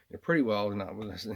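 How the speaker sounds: ordinary talk, nothing marked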